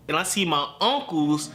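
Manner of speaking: ordinary delivery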